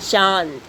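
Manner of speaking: चांद with the aa nasalised through nose and mouth; chandrabindu demo. Shown as a demonstration. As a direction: let चांद ring nasal on the aa. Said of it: "शब्द का उच्चारण हम करेंगे". (चांद का उच्चारण, अनुनासिक ध्वनि के साथ)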